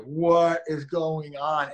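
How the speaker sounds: mock bewilderment. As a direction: stretch it out like you've lost the plot. Chaos of quarantine.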